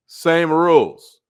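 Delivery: slow, loud, punched out word by word; emphatic slow declaration. Real, not acted. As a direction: hammer each word home like a preacher. Preacher hammering home a point.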